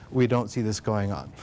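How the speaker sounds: ordinary delivery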